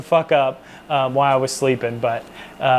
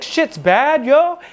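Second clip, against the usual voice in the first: put-on high, rising Cambodian-accented outrage, each yo jumping up; imitating Cambodian accent; 9 semitones higher, much slower than usual. Staff sergeant waking him at his bunk, Cambodian-accented angry squeal. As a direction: mimic the sergeant's Cambodian accent, pitch climbing to a yelp. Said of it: "he had a thick accent".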